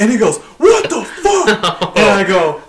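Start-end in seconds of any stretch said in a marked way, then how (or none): 0.6-2.0 high, drawn-out mock outrage; retold outraged outburst. Boss bursting out at a worker, outraged high cry. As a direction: imitate a furious boss, stretching each word high.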